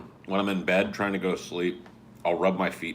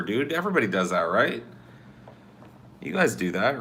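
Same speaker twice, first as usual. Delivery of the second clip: normal talking voice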